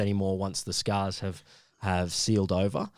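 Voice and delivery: ordinary talk, nothing marked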